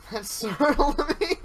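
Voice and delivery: sudden high, loud blurt; jolted into a loud squeal of surprise; startled exclamation. Real, not acted. Gamer startled by a mirror scare, loud high exclamation.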